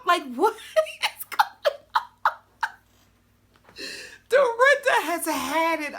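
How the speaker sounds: real laughing shriek; laughing disbelief. Real, not acted. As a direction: gasp through laughter in shock.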